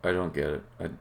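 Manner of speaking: ordinary talk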